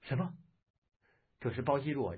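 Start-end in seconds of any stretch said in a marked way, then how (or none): 0.0-0.3 higher-pitched, startled question; stunned little question, pitched up; astonished. A narrator's reading. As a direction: blurt it out, taken aback. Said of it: "杨铁心愕然道".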